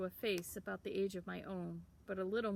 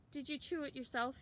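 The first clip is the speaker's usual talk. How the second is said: put-on higher, cocky kid's voice; teasing town boy; 6 semitones higher than usual. A narrator's reading. Town boy mocking Sam's deerskin, higher teasing voice. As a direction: poke fun at the rough sleeve.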